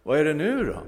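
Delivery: high, loud demanding what now; exasperated question. Acted. Theologian returning to ask the hippie, exasperated. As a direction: call out impatiently. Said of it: "frågade".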